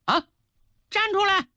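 stern questioning that jumps to a high-pitched command; angry queen. A narrator's reading. Furious queen questioning the schoolchildren, building to a sharp high order. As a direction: demand the culprit show themselves. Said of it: "也气坏了".

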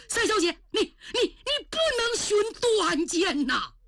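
high, frantic stammering plea; alarmed pleading. Acted. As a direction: grab her and beg, panicked. Stopping her suicide.

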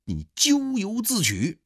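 high, loud storyteller's tirade; angry scolding. A narrator's reading. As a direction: hurl a rebuke, stretching the key words. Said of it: "刘铭传是大骂". (高声怒骂)

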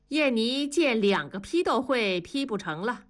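plain reading voice

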